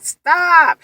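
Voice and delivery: high, loud retold plea; re-enacted urgent command; desperate protest. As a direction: cry out to make it end. Acts out telling dentist to stop.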